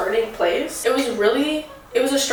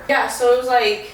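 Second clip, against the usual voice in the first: ordinary delivery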